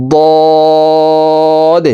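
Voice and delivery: "দদ" with the vowel held very long on a steady tone; four-alif stretch. Shown as a demonstration. Teacher showing how the letter is stretched, long level hold. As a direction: draw out "দদ" like a chanted note. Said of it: "দদ হরফ চার আলিফ টান হবে".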